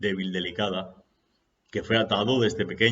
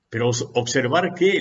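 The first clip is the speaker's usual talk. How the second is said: higher, louder desperate plea; desperate disciple; 4 semitones higher, 7 dB louder than usual. Acted. Disciple desperately questioning his master, higher and louder. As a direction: beg for an answer, at wit's end. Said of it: "le decía desesperado aquel discípulo".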